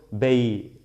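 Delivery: BEI with the final t left off; t ignorada. Shown as a demonstration. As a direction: end "bei" on the open vowel. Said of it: "voy a ignorar esta". ("bei" sin la t final)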